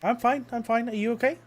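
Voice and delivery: Val reassuring the gnome she bumped; Val's voice. Acted. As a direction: pitch way up and reassure brightly.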